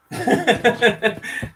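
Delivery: a high bright burst of laughter. Real, not acted. Laughing while showing friends the photo. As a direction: laugh out loud, high and free.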